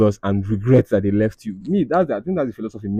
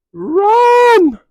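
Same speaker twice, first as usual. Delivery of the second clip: sudden high yell of run; shouted warning; 7 dB louder than usual. Real, not acted. Yelling at the viewer to flee. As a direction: shriek it like an alarm. Urgent advice to leave man.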